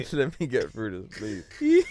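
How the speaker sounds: laughing too hard to talk; giggling squeaky pleading. Real, not acted. Laughing fit while trying to continue.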